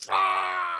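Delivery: loud, low drawn-out cry; long guttural burst; imitated goring noise. Imitates the goat goring dad.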